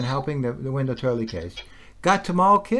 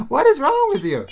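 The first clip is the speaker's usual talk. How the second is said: much higher and louder, exasperated; incredulous outburst; 11 semitones higher, 7 dB louder than usual. Real, not acted. Speaker to absent critics, exasperated incredulous outburst. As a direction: blurt it out in disbelief.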